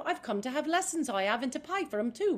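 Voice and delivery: spring up bright and sure; open Eliza. Shown as a demonstration. Actress demoing the right, unstressed Eliza reading, bright Cockney. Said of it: "as opposed to".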